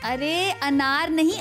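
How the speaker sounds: usual speaking voice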